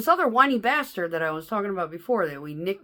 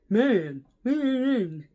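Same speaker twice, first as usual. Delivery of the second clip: mocking attitude; 6 semitones higher, 5 dB louder, much slower than usual. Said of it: "he got all attitude-y".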